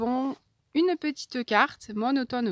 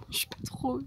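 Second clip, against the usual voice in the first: normal talking voice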